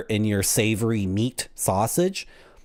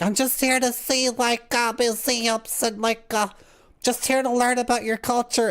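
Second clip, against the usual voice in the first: high, innocent tourist voice, much higher; naive tourist; 11 semitones higher than usual. Retold or imitated. A Canadian tourist at the North Korean border, high and innocent. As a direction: play the wide-eyed visitor talking his way in. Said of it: "and be like".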